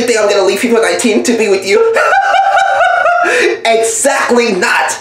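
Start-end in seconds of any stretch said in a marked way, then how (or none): 1.8-3.6 甲高く裏返った嘲笑; 見下すように高い声で笑い飛ばす; mocking laugh. Gloating over dumped lover.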